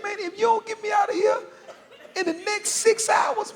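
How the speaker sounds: very high, whimpering tearful plea; hammed-up sobbing desperation; mock crying. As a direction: beg like you're in tears, voice way up high. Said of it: "he sound like he crying".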